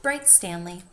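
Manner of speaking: ordinary delivery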